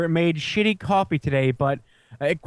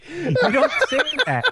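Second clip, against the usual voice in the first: laughing. Real, not acted. Laughing incredulous remark.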